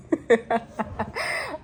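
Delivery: real high giggle; high laugh. Real, not acted. Vlogger laughing at her own pep talk, high giggle. Laughs after relax routine.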